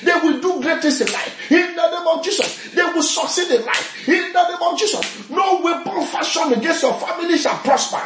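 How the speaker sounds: high, ringing preacher's decree; impassioned blessing building on every refrain; prophetic declaration. Real, not acted. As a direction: proclaim each promise forcefully over the crowd. Decrees protection over parents and children.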